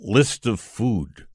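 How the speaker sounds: a lightly voiced, slow, deadpan small-town chef; deadpan Pierre. Retelling Tom's bit on Letterman, mildly deadpan slow chef. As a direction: drop the answer slow and flat as a joke. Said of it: "And he went".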